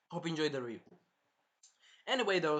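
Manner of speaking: usual speaking voice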